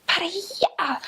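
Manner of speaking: high, breathed-out exclamation; imitated "way over there". Locals answering a request for directions, high airy exaggeration. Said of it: "whenever we'd ask for directions".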